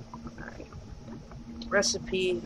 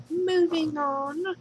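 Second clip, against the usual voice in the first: 1 sing-song dismissive; 10 semitones higher, much slower than usual. Real, not acted.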